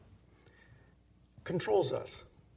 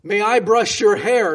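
loud, higher shout into a deaf man's ear; screaming into his ear; 6 semitones higher, 13 dB louder than usual. Retold or imitated. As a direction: yell it so the old man hears. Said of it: "she screams in his ear".

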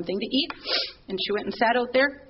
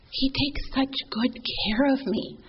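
whispered tenderness; 4 semitones higher, slower than usual. Retold or imitated. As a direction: breathe it out gently, slowly. Mother quoted, soft and tender.

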